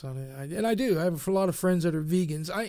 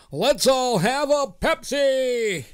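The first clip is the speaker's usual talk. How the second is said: slow, loud, higher hammed-up commercial cheer; mock ad slogan; 7 semitones higher, 4 dB louder, slower than usual. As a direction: sell it like a soda jingle. Mock ad slogan after confession.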